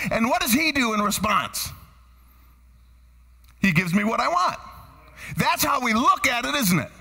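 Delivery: ordinary talk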